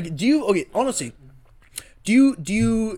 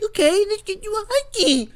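high-pitched, louder gushing imitation; mock eager agreement; 5 dB louder than usual. Host mocks himself agreeing to girlfriend's husky, over-eager. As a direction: give in with exaggerated enthusiasm.

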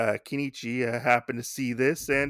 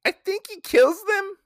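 pitched way up, unsure; high unsure guess; 5 dB louder than usual. Real, not acted. A pitched-up aside to viewers, unsure.

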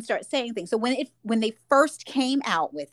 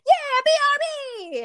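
put-on high, drawn-out kid cheer; hammed-up, high-pitched child's voice; child in a play; 4 dB louder than usual. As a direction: play an over-eager child actor. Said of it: "I'm portraying a child".

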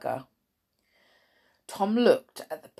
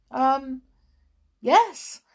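higher, hesitant reply; timid boy; 7 semitones higher, 4 dB louder than usual. A narrator's reading. Tom replies to matron, timid. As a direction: answer like a cowed schoolboy.

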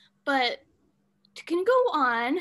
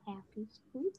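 spooky hush; 8 semitones higher, 10 dB quieter, much slower than usual. Real, not acted. Spooky season greeting.

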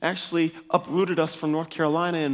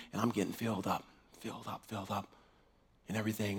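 much softer and lower, fading; awed hush; 8 semitones lower, 14 dB quieter, faster than usual. Retold or imitated. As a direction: let it sink into a hush. Being filled in prayer, fading.

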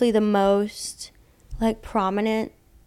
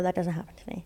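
whispering; 7 dB quieter, faster than usual. Real, not acted. Quiet aside about ghosts.

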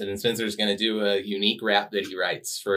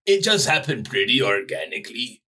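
dumb brute reading a quote; caveman voice. Acted. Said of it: "That's a good caveman voice".

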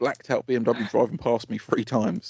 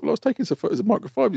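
retold reply; 11 semitones higher, faster than usual. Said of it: "I was like".

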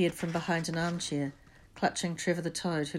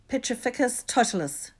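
higher, louder spell-casting call; cried out; 6 semitones higher, 5 dB louder than usual. A narrator's reading. Hermione casting a spell at Neville, crying out. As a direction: cry out the incantation. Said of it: "she cried".

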